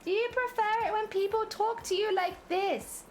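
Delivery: put-on high, slow anime-girl coo; wide-eyed cutesy high voice; high-pitched cutesy voice. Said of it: "I can speak high-pitched as well".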